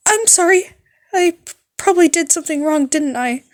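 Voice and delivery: high, loud panicked apology; high anxious girl voice, loud; nervous nurse. Acted. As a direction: gush out the apology in a fluster.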